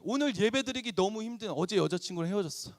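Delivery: ordinary talk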